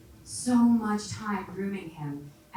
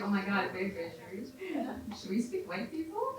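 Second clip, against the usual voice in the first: hushed aside rising on the last words; conspiratorial kid; 7 dB quieter than usual. Acted. Comedian as a child egging on a best friend, conspiratorial. Kids mocking tourists, acted.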